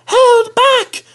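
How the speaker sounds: very high squeaky held back; high conspiratorial squeak; whispered coach voice. A narrator's reading. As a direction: pass the secret like a giggling coach. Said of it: "whispered Jemima to Lucy".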